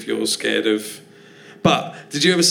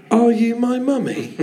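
put-on high eerie child's plea; creepy child ghost; 10 semitones higher than usual. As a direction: ask it like a ghostly lost child. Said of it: "Doctor Who episode".

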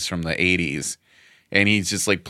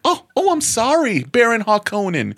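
much higher squeaky cartoon voice. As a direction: squeal the apology in a goofy voice. Silly Dune voice bit.

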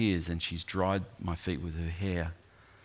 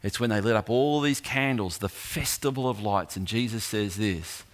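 ordinary talk, nothing marked

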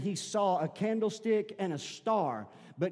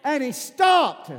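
loud, ringing preacher's cry climbing higher; preaching at full force; 6 semitones higher, 8 dB louder than usual. Real, not acted. Pastor rousing the church about Bartimaeus, loud and high preaching. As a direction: lift the congregation with a booming sermon.

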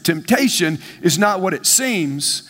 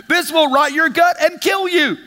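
mock warning label; 7 semitones higher than usual. Preacher shouts the ibuprofen bottle's warning to the congregation, hammed-up. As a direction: bellow the warning like a label screaming at you.

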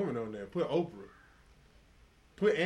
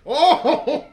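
high, loud whoop breaking into a laugh; 13 semitones higher, 19 dB louder than usual. Real, not acted. Friend swooning over an actress, whooping loud with laughter. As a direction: let out a wild laughing whoop.

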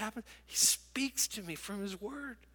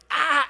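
mock yelp; 8 semitones higher, 10 dB louder than usual. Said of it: "the kids came, and they're like".

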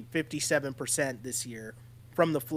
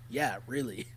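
low, quieter, stone-faced reply; deadpan; 4 semitones lower, 7 dB quieter than usual. Retold or imitated. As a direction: imitate someone confirming it without a flicker of humour. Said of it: "completely deadpan, stone face".

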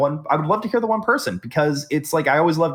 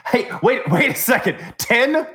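incredulous; 8 semitones higher, 3 dB louder than usual. Real, not acted. Disbelief at sample size.

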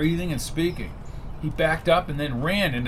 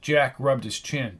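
ordinary delivery, nothing marked

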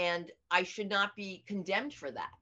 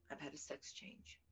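unvoiced confession; whispered confession; 4 semitones lower, 19 dB quieter than usual. Telling Peter her secret in a whisper. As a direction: breathe it out like a secret.

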